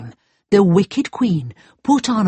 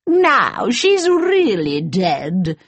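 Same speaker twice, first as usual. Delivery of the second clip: slow, gloating evil queen; much slower than usual. Acted. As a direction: savour it slowly, triumphant and wicked. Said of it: "cried the wicked queen".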